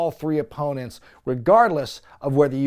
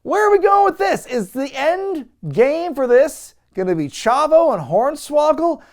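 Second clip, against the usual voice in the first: much higher and louder, exasperated disbelief; baffled shout; 10 semitones higher, 6 dB louder than usual. Retold or imitated. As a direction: yell it out, totally lost. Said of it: "And I'm like".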